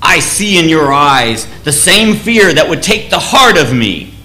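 a king steeling his men before battle; a solemn war cry before the gates of the evil empire; rousing speech. Retold or imitated. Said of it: "gives this rousing speech to the men".

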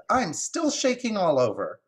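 high-pitched agitated squirrel voice; shaken squirrel. Acted. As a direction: play a squirrel still rattled by a fright. Squirrel in story, shaken witness.

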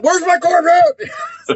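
high, drawn-out mock holler; demanding prize winner. Duped winner rolling up for the Corvette, mock hollering.

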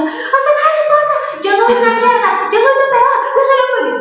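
very high, loud mock wailing; high-pitched, melodramatic retold tirade; mother yelling. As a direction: imitate a hysterical mother screaming in self-pity. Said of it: "me empezó a gritar".

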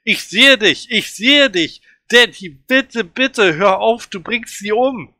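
high, loud pleading shriek; a child screaming at his father to stop strangling her; desperate scream. A narrator's reading. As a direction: scream at him to stop, begging. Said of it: "Ich schreie".

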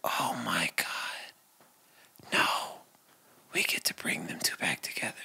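soft, breaking whisper of realisation; tearful whisper. Retold or imitated. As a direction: barely breathe it out through tears.

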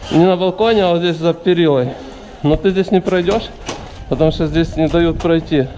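ordinary delivery

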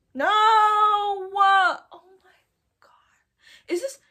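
loud, long high "no", then breathy whisper; horrified shock. Real, not acted. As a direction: wail it, then drop to a stunned whisper. Horrified by what the page shows.

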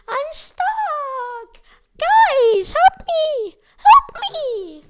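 high, very loud cry for help; hammed-up wailing plea; high screaming plea; mock panicked wail. Stuck, pleading for help.

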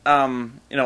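usual speaking voice

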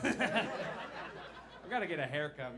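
normal talking voice

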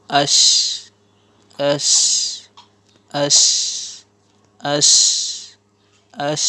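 "ash" with a long, strong sh; "ash" repeated singly, with pauses; drilled "ash". Shown as a demonstration. Teacher shows alif zabar shin jazm, drilling "ash". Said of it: "উচ্চারণ".

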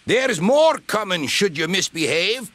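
high, scolding threat; angry warning. A narrator's reading. Donald threatens the bumped van.

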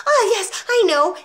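high, loud burst of realisation; schoolboy suddenly sure of the answer; eager little boy. A narrator's reading. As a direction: pipe up excitedly, very high. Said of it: "Eric said".

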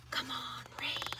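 breathy hushed plea to the sky; whisper. A narrator's reading. Girl on the rooftop wishing for rain, whispering. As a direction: whisper it like a secret wish. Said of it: "I whisper".

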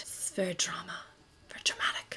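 soft whisper, "very dramatic" breathed out. Real, not acted. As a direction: whisper it like a secret. Whispering about dramatic look.

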